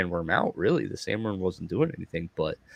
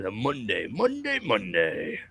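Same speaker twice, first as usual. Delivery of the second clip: booming monster truck commercial announcer. Retold or imitated. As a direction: hype it like a monster truck rally ad. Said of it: "what's that monster truck commercials".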